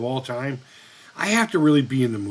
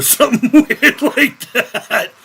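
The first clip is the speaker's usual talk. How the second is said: higher, laughter breaking through the words; laughing while talking; 9 semitones higher, 6 dB louder, slower than usual. Real, not acted. Streamer recalling a bootleg toy name, talking through laughter. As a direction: let real laughter shake the phrase.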